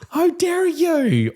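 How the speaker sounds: high, drawn-out hammed-up indignation; teacher playfully scandalised by a pupil; mock offended. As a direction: stretch it out in exaggerated outrage. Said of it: "I just was like".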